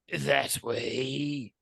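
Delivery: drawn-out creature voice; minion voice. Acted. Minion bows and shows the archway, drawn-out creature voice. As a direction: stretch it out as you point the way. Minion directs the players onward.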